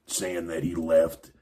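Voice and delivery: normal talking voice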